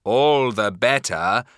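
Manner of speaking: loud, menacing wolf voice; big bad wolf. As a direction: snarl it as the hungry wolf. Wolf answers.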